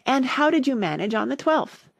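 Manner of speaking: eager question, pitched high. A narrator's reading. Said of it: "Alice went on eagerly".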